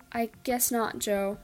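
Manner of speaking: plain reading voice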